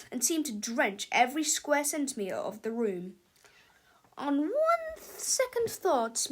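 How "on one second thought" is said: high, slow, stunned teacher voice; dismayed teacher. A narrator's reading. Miss Ribble covered in goo, stunned and slow. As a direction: drag it out, shocked and dripping. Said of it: "said Miss Ribble".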